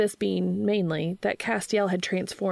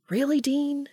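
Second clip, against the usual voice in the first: exasperated; 8 semitones higher than usual. A narrator's reading. Sam exasperated.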